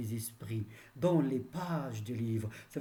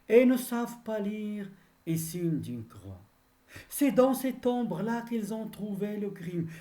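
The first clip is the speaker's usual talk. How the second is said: raised, ringing declamation; poem recitation; 8 semitones higher, 5 dB louder than usual. Acted. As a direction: launch each verse high and loud, then let it fall. Recites the verse between explanations.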